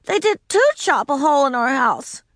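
put-on high, insistent little girl; insisting. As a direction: press the point, a child. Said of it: "Ramona insisted".

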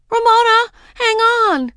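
very high, loud panicked shout; urgent call. A narrator's reading. Daisy urging dangling Ramona, high urgent shout. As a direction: call out desperately to a friend. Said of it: "Daisy called out".

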